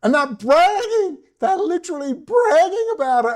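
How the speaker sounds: high-pitched, worked-up exasperation; exasperated rant. Real, not acted. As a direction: plead it at a rising pitch, incredulous.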